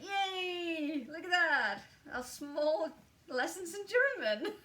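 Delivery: high, loud, gleeful celebration; excited cheer. Retold or imitated. Reader re-enacting delight at understanding the German, excited cheer.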